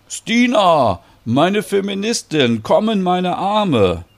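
high, loud, delighted greeting; high and drawn-out, arms-open greeting; exuberant welcome. Acted. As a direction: welcome a friend with big, effusive joy. Exuberant greeting of returned friend.

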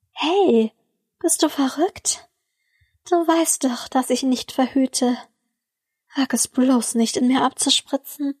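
lightly voiced woman complaining in jest; laughing complaint. A narrator's reading. Woman playfully telling her lover off, mock complaint. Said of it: "beschwerte sie sich lachend".

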